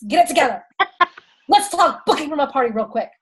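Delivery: playful mimicry of a friend's accent; imitating Alyssa's accent. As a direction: put on her accent, teasingly. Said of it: "That's kinda what she says".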